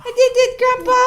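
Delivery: put-on high, loud little-kid cheer; high, loud proud toddler voice; voicing the toddler. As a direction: speak for the baby, thrilled. Mom voicing toddler's excitement.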